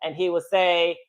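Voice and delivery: ordinary delivery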